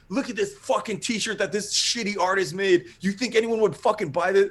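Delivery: high-pitched mocking wrestling promo; Roddy Piper. Wrestler cutting a promo for the artist's gear, scornful rant. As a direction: trash the merchandise like an indignant wrestler. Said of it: "Can you cut promos".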